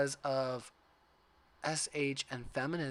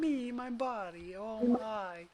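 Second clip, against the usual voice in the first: higher, vain mock voice; mocking Craig; 9 semitones higher, slower than usual. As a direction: play a self-absorbed guy admiring himself. Mocking Craig's self-obsession.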